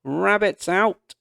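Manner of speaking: high, loud, slow cry; cried out. A narrator's reading. Girl crying out to mum that the rabbit escaped, loud. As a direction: shout the alarm to the house. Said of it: "Katya cried".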